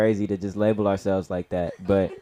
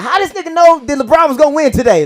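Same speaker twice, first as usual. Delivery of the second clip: baffled bettor; 11 dB louder than usual. Retold or imitated. People wondering about him.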